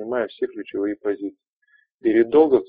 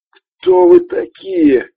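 strained gasp; 10 dB louder, much slower than usual. Acted. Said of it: "выдавил он с трудом".

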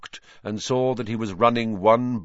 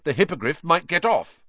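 stout; 8 semitones higher, 3 dB louder than usual. A narrator's reading. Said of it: "said Ron stoutly".

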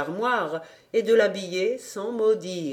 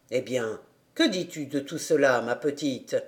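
lower, gentle father voice; kindly gentleman; 8 semitones lower, 3 dB quieter than usual. A narrator's reading. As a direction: speak down softly to the child. Voix du monsieur lue. (voix grave et douce de monsieur Zezeman)

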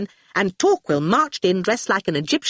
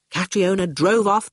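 ordinary delivery, nothing marked

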